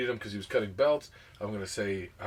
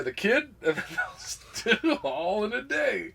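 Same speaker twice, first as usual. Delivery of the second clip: laughing quip; 8 semitones higher, slower than usual. Retold or imitated. Laughing through quoted quip.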